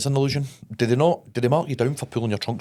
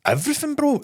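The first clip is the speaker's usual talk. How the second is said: exclaiming. Real, not acted.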